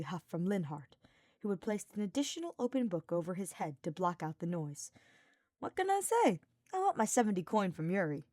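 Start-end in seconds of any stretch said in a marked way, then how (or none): 5.6-8.3 breezy, higher and louder; casual shrug. Acted. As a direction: toss it off lightly. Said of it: "Sylvain shrugged".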